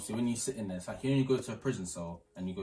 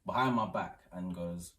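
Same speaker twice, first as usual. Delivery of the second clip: usual speaking voice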